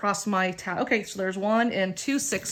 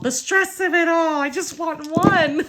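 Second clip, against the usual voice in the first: higher, louder pleading wail breaking into laughter; mock-desperate wail; 8 semitones higher, 4 dB louder than usual. Real, not acted. Player begging the dice for one bunco, desperate.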